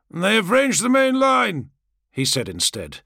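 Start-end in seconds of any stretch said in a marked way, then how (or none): 0.1-1.7 high, loud battle warning; involuntary outburst. A narrator's reading. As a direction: blurt it out as a raised, compulsive report. Tries yes, wrong words burst out.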